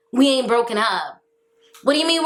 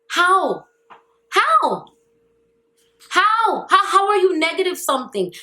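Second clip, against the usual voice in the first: higher, raised disbelieving how; can't believe how useless she was; high-pitched exasperated disbelief; incredulous; 7 semitones higher than usual. Real, not acted.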